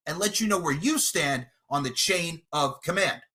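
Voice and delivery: ordinary delivery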